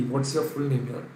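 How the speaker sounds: usual speaking voice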